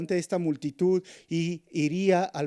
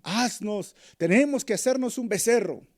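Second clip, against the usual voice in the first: high, loud demand; people demanding; 7 semitones higher, 6 dB louder than usual. Retold or imitated. Israelites pressing Aaron for a calf, loud demand. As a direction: demand it like an impatient crowd.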